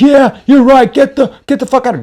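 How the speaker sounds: very high, loud mock yell; high, strained taunting shout; angry man. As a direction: imitate a furious man claiming victory. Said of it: "And he's so angry".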